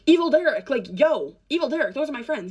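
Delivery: begging him to let her out; urgent plea. Retold or imitated. Pleading with captor in car.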